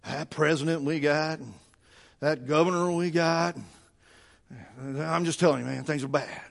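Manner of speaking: low, sour imitation of a man moaning about prices; grumbling complainer. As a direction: mutter like a weary pessimist at the store. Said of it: "And you know what you're gonna get?".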